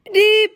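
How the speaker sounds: high sharp whistle imitation; whistle beep. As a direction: squeal it out like a whistle.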